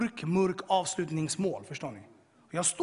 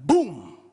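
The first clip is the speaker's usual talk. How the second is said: sudden, very loud boom; explosion boom; 12 dB louder than usual. Retold or imitated. Imitates the cellar exploding. (plötsligt, dånande bom)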